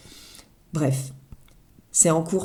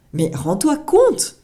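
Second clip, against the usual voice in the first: excited; 7 semitones higher, 6 dB louder, slower than usual. Real, not acted. Exclaiming at the change.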